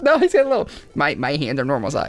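high indignant protest; squeaky offended villain; defensive character voice. Pretend. Voicing Ganon defensively.

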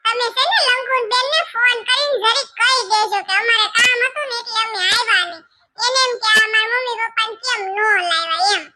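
high, put-on voice of a fretting daughter; young daughter voice. Daughter complaining to her mother about the scolding, high voice. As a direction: play the daughter, pitched well up, a little tearful near the end. Daughter's voice held for many lines.

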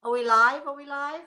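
mock fretful asking, rising; playful nagging question; teasing repeat. Real, not acted. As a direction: tease the co-host by asking again. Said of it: "I must seem to be really crazy when I'm saying".

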